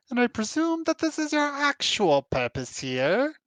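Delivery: high, grand creature voice; lofty, high creature voice; Quattro voice. Acted. Quattro voice again.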